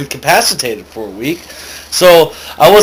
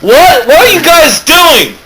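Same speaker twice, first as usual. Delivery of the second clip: high, loud shocked outburst; startled protest; 12 semitones higher, 5 dB louder than usual. Acted. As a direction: yelp it in disbelief. Agents arrest him suddenly.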